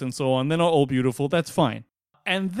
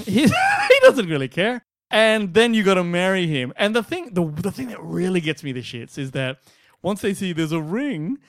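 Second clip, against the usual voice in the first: normal talking voice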